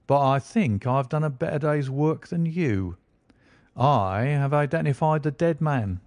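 a self-satisfied detective gloating a little; smug inspector. Acted. Lestrade boasting to Holmes.

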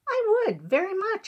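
delighted high-voiced little man; eager acceptance. A narrator's reading.

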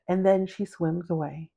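ordinary delivery

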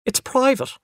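put-on high, small child's voice; timid high-voiced excuse; a little afraid. A narrator's reading. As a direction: say it defensively, pitched well up. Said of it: "a little afraid".

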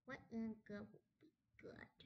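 whispered musing to himself. Real, not acted. Gamer whispering while picking an option. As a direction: hush it, thinking aloud.

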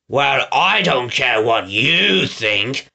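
slow, scornful retort; snapping villain. Acted. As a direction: snap back, dragging out each word. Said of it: "Snatcher snapped".

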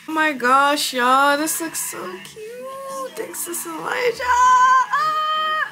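high, squealy delight; overjoyed gushing. Real, not acted. Client thanking her stylist for cute hair, overjoyed. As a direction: gush with the pitch climbing to a squeal.

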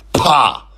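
loud dismissive pah; scornful pah. Acted. Philip scoffing at Gladys, loud scornful pah. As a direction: spit out scorn. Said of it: "Philip retorted".

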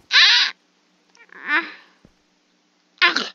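sharp high yelps, loud; startled screams. Real, not acted. Gamer yelping at sudden damage, startled screams. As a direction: yelp in real fright at each hit. Startled while playing a game.

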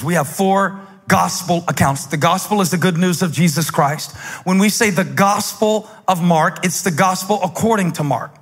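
usual speaking voice

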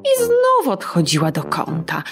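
ordinary delivery, nothing marked

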